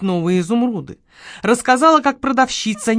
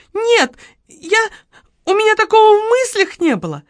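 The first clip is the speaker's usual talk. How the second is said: very high, loud panic; squeaky, flustered shop girl; frightened denial; 13 semitones higher, 4 dB louder than usual. A narrator's reading. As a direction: protest desperately, breaking off and restarting.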